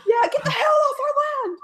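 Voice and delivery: high shouted echo of the threat; echoing the tribe's warning as a high, playful shout; mock shout. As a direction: yell it back for fun.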